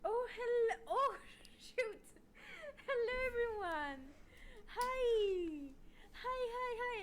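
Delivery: high, loud, squealing hellos with laughter; excited greeting. Real, not acted. Streamer greeting raid viewers as they arrive, squealing with excitement. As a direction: burst out greeting the raiders, thrilled.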